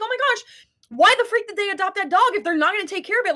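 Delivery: Oh my gosh, pitched high and indignant; outraged exclaim. Retold or imitated. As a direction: throw up your hands at the neglect. Imagined outraged reaction.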